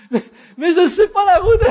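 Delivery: high, loud, hammed-up panic; panicked protest. Lost driver followed by every car, panicking. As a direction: protest loudly in comic panic.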